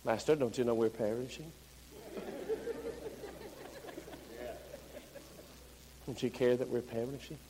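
low, restrained polite plea; measured, subdued request in a low pitch; carefully worded. Acted. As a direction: ask softly and carefully, minding every word. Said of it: "Did I word that just right?".

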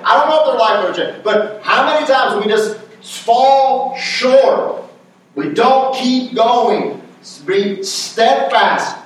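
emphatic, driving preacher delivery; fervent preaching. Real, not acted. Preacher urging the congregation not to quit early, fervent preaching. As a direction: preach it with punched, stretched key words.

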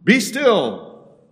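loud, high commanding shout; full-force bellow, pitched high. Acted. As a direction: bellow it as an order to a storm. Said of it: "bellowing at the wind".

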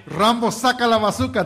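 loud, high parody of tongues; mock speaking in tongues. Imitating what pastors say in tongues, high mock shout. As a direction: fire it out like an overexcited preacher. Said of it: "that's what they say".

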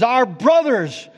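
"Our brothers" with "our" pushed up and hard; loud part. Shown as a demonstration. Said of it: "the loud part is".

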